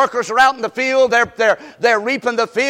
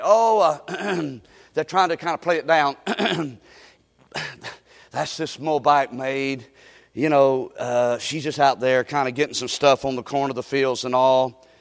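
downplaying worker; 8 semitones lower, 5 dB quieter than usual. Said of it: "one of his workers say".

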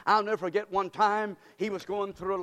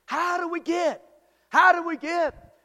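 rising preacher's call, high and loud; preaching question; 9 semitones higher, 7 dB louder than usual. Acted. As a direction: hammer the question home. Preacher repeating question louder.

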